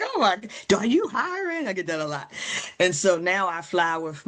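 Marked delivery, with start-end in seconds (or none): 0.0-1.7 high-pitched, awed job seeker, imitated; mock eager excitement. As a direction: blurt it like an envious stranger wanting in. Imitating eager airport staff.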